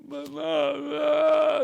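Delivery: high, loud mocking blah blah; mocking mom's nagging. Mocking his mother's scolding, high loud blah blah. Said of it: "That was my mom".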